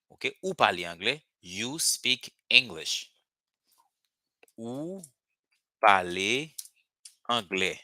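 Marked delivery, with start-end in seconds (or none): 4.6-7.8 slow teacher's model with gaps between words; spaced-out, deliberate dictation. Shown as a demonstration. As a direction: break it up word by word for learners. Teacher models the sentence word by word.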